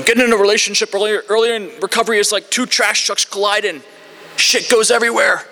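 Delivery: put-on high-pitched, quick old-timer voice; old-timer impression. Old-timer telling meetings his analogy, high put-on voice. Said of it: "this one guy can't do an Irish accent".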